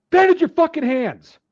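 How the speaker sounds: high, loud barked order; imitated sergeant yell. Instructors ordering bleeding recruit to patch up, imitated yell. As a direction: bark it at an injured recruit.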